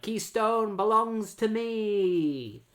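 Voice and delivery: high, drawn-out declaration; mock villain proclamation. Retelling the villains claiming the city, mock proclamation.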